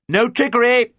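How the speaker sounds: high, loud warning shout. A narrator's reading. Captain warns the surrendering pirates, shouting up. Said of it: "shouted John".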